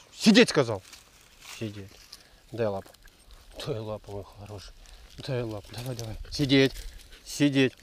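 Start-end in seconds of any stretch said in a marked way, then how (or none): 1.6-6.2 gentle coaxing. Real, not acted. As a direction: coax the dog tenderly. Ласково с собакой. (ласково и тихо)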